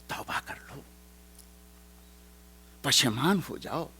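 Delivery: whispered plea to repent; soft whispered call to repent. Acted. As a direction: breathe it out unvoiced, pleading. Preacher softly urges repentance.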